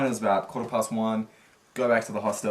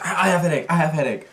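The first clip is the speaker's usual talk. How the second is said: higher, louder mocking of her broken English; mocking impression; 6 semitones higher, 6 dB louder, faster than usual. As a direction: parrot her words back at her. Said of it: "and we're like".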